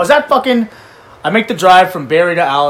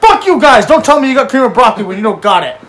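loud, higher yell; retold yell; 5 semitones higher, 6 dB louder than usual. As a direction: yell it at the staff in a fury. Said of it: "I said".

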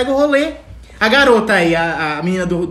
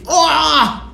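mock exclamation; 6 semitones higher, much slower than usual. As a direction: yell at the girl, fed up. Mocking the screaming girl. (exclamação exagerada e irritada)

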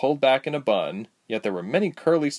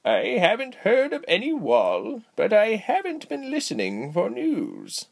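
put-on high, slow, swooping old lady voice; cheery aged healer, pitched up high; cheerful old woman; 12 semitones higher than usual. A narrator's reading. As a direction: draw it out sweetly like a cheery granny.

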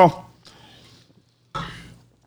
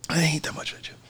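breathy pained whisper; 15 dB quieter, much faster than usual. Real, not acted. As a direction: whisper it, wrecked by spice. Strained whisper after spicy gummy.

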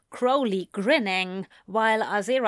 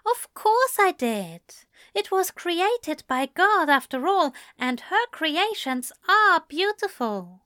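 higher angel voice, earnestly devout; prim angel, earnest; 10 semitones higher than usual. Acted. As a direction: affirm it properly, as a believing angel. Voices Aziraphale in dialogue.

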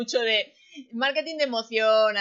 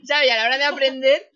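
laughing, louder; 2 semitones higher, 8 dB louder than usual. Real, not acted. Host still laughing as she explains how she learns. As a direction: carry the laugh into the sentence.

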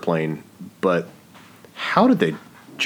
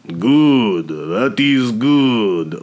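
Russian mobster quote; 3 semitones higher, 5 dB louder, much slower than usual. Retold or imitated. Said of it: "that dude said".

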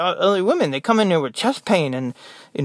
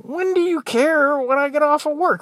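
indignant mock reply; 11 semitones higher than usual. Nurse answering patients who ask about quitting time, indignant. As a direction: stammer into an exasperated, high-pitched comeback.

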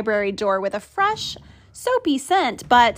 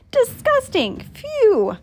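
disgusted; 11 semitones higher than usual. Acted. Narrator reacts to the pirate's odor.

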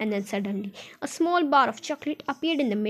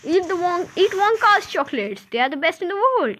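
higher-pitched advertising pitch; commercial voice; 6 semitones higher, 3 dB louder than usual. Acted. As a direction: sell it like a TV jingle announcer. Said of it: "one voice will say".

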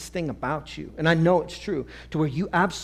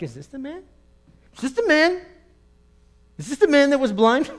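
disbelieving neighbours; 9 semitones higher, 7 dB louder than usual. Acted. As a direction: ask it higher and louder each time. Neighbours question who he is.

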